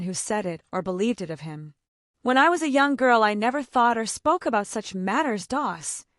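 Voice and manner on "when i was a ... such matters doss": a higher, louder crushing put-down; crushingly. A narrator's reading. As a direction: flatten her with every word. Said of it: "said Aunt Wellington crushingly".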